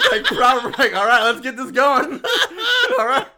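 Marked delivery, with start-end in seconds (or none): 0.9-2.2 laughing mock Kane. Player voicing Kane, laughing.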